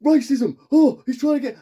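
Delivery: high, loud mock outrage; going mental. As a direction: imitate a cinema crowd crying foul. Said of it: "mental about it".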